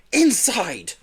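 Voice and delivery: usual speaking voice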